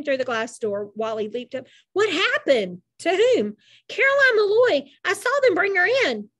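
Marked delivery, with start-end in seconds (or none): 1.9-6.3 high, louder, urgent voice; frantic questioning. A narrator's reading. Wally leaping up to the nurse, urgent. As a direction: blurt it out anxiously, pitched up.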